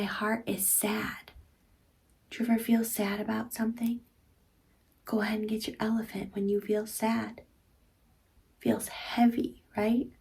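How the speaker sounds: hushed, gentle sad voice; soft sad whisper. Acted. Reading the sad page.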